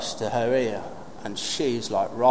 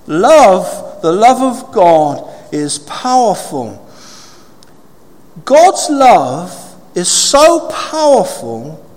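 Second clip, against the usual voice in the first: loud, high, slow proclamation; full-force preaching; 10 semitones higher, 8 dB louder, slower than usual. Real, not acted. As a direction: preach it big, with long pauses between phrases.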